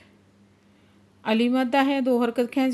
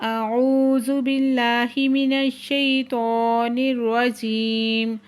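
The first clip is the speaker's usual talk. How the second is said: slow chanted tajweed recitation; Quran recitation; 3 dB louder, much slower than usual. Acted. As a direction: draw out each phrase as in tilawat. Teacher recites the verse phrase by phrase.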